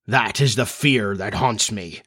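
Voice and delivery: plain reading voice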